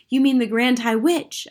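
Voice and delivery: put-on boy astonished; cried out. A narrator's reading. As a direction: blurt it out in surprise. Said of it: "I cried".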